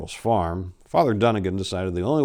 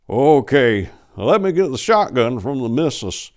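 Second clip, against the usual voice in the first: put-on a worried old farmer pleading; old farmer Pickles; 5 semitones higher, 6 dB louder than usual. A narrator's reading. Mister Pickles voiced in story.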